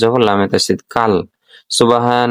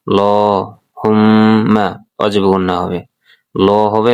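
ordinary delivery

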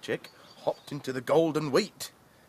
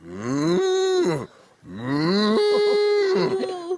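loud mooing bull; bellowing bull; 8 dB louder than usual. Acted. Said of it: "bellowed a bull".

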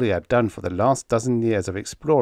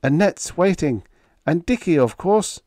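high, urgent persuasion; desperately offering reasons; desperate coaxing; 9 semitones higher, 5 dB louder than usual. A narrator's reading. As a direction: coax him, voice pitched up and pressing. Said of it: "Kirk said desperately".

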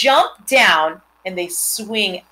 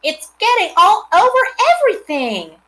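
put-on high, loud little girl's shout; girl shouts at her brother, high and loud; 13 semitones higher, 7 dB louder than usual. A narrator's reading. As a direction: yell it out as the excited girl. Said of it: "shouted Fern".

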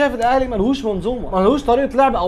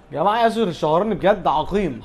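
ordinary talk

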